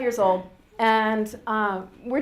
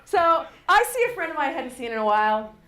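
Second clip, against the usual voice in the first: ordinary talk, nothing marked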